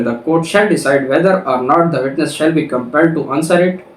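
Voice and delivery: normal talking voice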